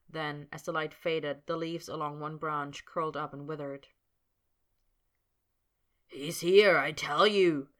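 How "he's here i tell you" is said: loud gravelly call. A narrator's reading. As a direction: call it out roughly and loudly. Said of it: "said a gravelly voice, louder, closer".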